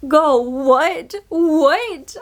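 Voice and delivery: shocked, rising "what"; stunned disbelief. Retold or imitated. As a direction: react like you can't believe the twist. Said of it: "I was like".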